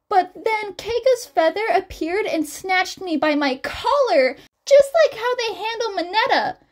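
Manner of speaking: high, loud excited squeal; high-pitched girlish squeal, breathless with excitement. Acted. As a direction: gush it out like a thrilled teen girl. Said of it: "almost squealing".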